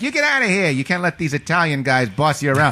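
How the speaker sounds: very high mock scrappy kid; hot-tempered kid. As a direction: egg your friend on, high and cocky. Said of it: "So he's like".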